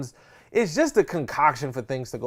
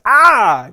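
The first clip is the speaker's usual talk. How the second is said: mock excited yell; 8 semitones higher, 14 dB louder than usual. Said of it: "they be like".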